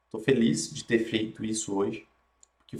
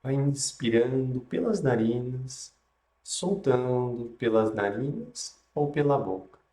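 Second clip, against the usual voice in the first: guided meditation; 3 dB quieter than usual. Acted.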